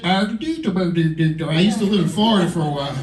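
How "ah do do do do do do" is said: mock a dopey Southern accent. Shown as a demonstration. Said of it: "Southern accent".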